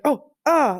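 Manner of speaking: high, loud mock yelp of fright; startled yelp. Coach startled by water splashed on him, a high yelp. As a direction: imitate a coach jumping as cold water hits him. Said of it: "kind of freaking out a little bit".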